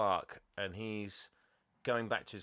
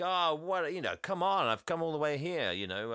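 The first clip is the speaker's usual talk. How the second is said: higher, louder acted-out pleading; mock pleading; 7 semitones higher, 4 dB louder than usual. Rejected ex to the woman, mock pleading.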